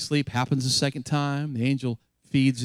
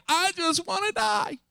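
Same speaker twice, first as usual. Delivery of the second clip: despairing Elijah. Retold or imitated. Despairing prophet quoted in sermon.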